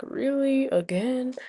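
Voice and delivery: understated re-enacted shock; retold shock. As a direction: ask in mild, quiet disbelief. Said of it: "I was in shock".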